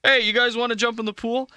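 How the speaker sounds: put-on high, eager awkward teen; awkward teenager. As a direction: ask it like a nervous teen. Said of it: "how every awkward seventeen-year-old talks".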